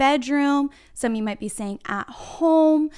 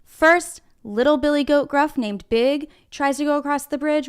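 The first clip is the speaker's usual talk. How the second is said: ordinary talk, nothing marked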